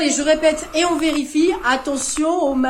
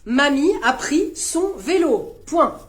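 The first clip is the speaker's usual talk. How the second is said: P=B; slow dictation; 4 dB louder, slower than usual. Shown as a demonstration.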